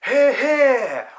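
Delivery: loud, high roar of approval. Acted. Otter roaring approval at the fly's speech. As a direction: bellow it out in agreement. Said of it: "Oscar Otter roars".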